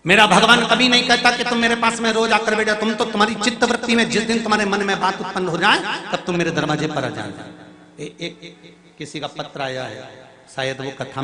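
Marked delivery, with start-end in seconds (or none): 0.0-5.8 loud, high, fast preaching; preacher urging devotees toward Shiva; fervent preaching. Acted. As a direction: pour it out with devotion. Preaching again with fervour.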